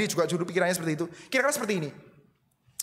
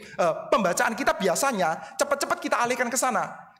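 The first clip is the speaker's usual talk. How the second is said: ordinary talk, nothing marked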